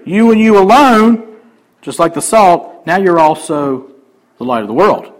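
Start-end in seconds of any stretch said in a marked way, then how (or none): 0.1-1.2 "you" and "alone" both louder; loud emphatic "you alone"; emphasis on alone. Shown as a demonstration. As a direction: press "alone" home. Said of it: "Same emphatic word here".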